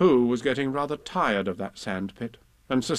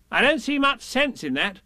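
Rabbit voice; 11 semitones higher than usual. Acted. Rabbit voicing.

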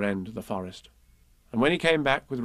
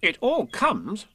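slow, high-pitched stern rabbit; 9 semitones higher, slower than usual. A narrator's reading. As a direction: retort sternly, pitch rising at the end. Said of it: "said Rabbit sternly".

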